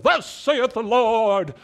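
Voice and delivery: loud, high, drawn-out mock proclamation; booming prophet. Preacher parodies someone walking up to prophesy, booming and drawn out. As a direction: thunder it out like an over-the-top prophet. Mocking a dramatic prophet's announcement.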